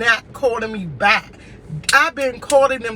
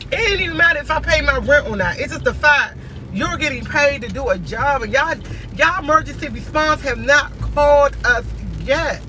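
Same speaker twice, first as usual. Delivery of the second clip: normal talking voice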